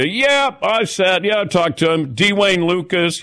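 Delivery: high-pitched, puffed-up name-dropping; mock know-it-all. Answering impressed racing people, mock know-it-all. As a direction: brag lightly like a fake insider. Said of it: "trying to sound like I knew".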